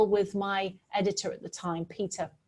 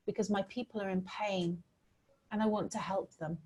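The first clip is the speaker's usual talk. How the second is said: soft retelling; 8 dB quieter than usual. Retold or imitated. Quoting the child's answer.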